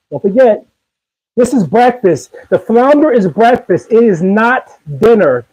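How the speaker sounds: ordinary delivery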